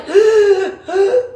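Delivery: a loud wheezing gasp with a laugh; mock panicked gasp. Telling viewers about panic mid-spar, a laughing gasp for air. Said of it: "I was like".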